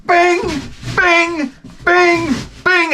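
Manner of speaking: high, sharp imitated bings; ping it out like a pinball machine; pinball bing noises. Comic imitates a kid's golf ball bouncing, with high pinball bings.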